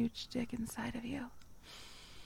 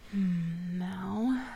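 ordinary talk, nothing marked

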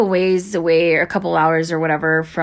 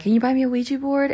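put-on higher-pitched childlike request; kid asking dad; 6 semitones higher, faster than usual. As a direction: lightly voice a child asking a parent. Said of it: "when I was a kid".

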